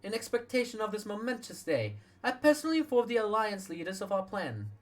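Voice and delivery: proclaiming leader. Acted. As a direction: announce it like a public vow.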